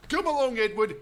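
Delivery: a hint of a cheery driver calling; driver's voice. Driver urging Edward along, lightly voiced. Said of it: "said the driver".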